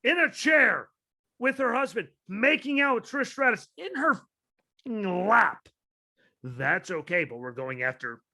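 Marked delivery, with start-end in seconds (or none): 0.0-5.7 incredulous. Real, not acted. Incredulous ranting description.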